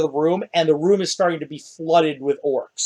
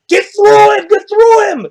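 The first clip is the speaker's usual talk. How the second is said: very high, loud orc yells; shouting orc horde; 7 dB louder than usual. Acted. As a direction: shriek orders from behind, high and loud. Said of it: "all these orcs".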